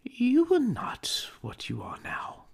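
put-on a young woman letting go of her fiancé; Belle, sorrowful. Belle parting from young Scrooge.

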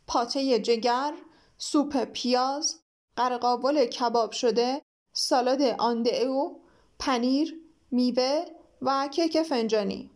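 slow, deliberate listing of each dish; measured. Acted. King announcing the French menu, slowly and deliberately. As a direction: savour every dish name one by one. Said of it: "با لحنی شمرده".

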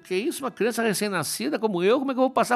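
put-on high little-child voice, protesting; Hermes playing innocent. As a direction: plead your case like a wronged newborn. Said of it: "diz o pequenininho".

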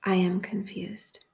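slow, measured prayer with long pauses; guided meditation prayer. Acted. Host praying phrases for listeners to repeat, slow guided meditation. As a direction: say each phrase calmly and unhurried, leaving room to repeat.

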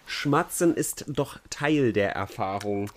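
usual speaking voice

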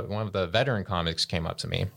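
usual speaking voice

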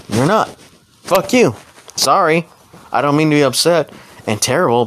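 ordinary talk

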